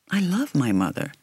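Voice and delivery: plain reading voice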